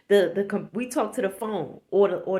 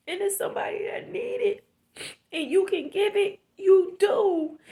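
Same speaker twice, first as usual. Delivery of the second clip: crying; 6 semitones higher, 4 dB louder than usual. Real, not acted. Vlogger pleading with viewers about greed, crying. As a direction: voice breaking into tears, pitch up. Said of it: "I'm snotting and crying".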